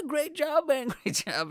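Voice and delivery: very high, overdone encouragement; mock a pitying well-wisher; fake praise. Onlookers consoling the failed comedian, high insincere praise.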